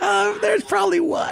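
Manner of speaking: high laughing yell; laughing too hard to speak plainly; laughing shout. Real, not acted. Laughing interjection.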